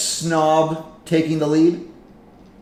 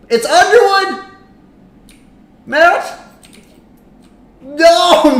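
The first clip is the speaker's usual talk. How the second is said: excited yell; 10 semitones higher, 5 dB louder, slower than usual. Real, not acted. Close finish of the race.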